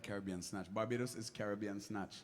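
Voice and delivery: usual speaking voice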